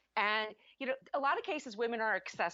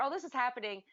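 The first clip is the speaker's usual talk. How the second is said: ordinary talk, nothing marked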